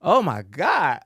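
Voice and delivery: loud, high, drawn-out horror; slow, wailing disbelief at the insult; acted dismay. Student retells hearing her accent called horrible, aghast. Acts out reaction to accent criticism.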